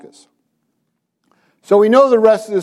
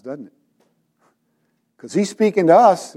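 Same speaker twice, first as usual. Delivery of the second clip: normal talking voice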